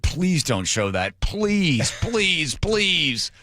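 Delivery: slow, drawn-out mock begging; imitating a pained plea; retold pleading. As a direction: plead with rising pitch, stretching each word. Marketing department begging on phone.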